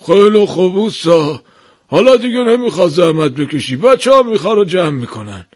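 high master's voice, restrained patience; trying to look calm. A narrator's reading. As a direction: keep composed while telling the worker to stop. (تلاش برای آرام ماندن)

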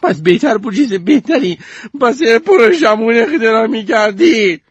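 put-on high, scornful girl voice; sarcastic retort. Goldie retorts at Sneeze, high scornful voice. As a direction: throw it back at him.

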